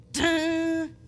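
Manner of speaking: held sung keyboard note; sung 'dun'. Retold or imitated. Preacher asking keyboardist for emphasis chords, sung 'dun'. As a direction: imitate a held organ chord.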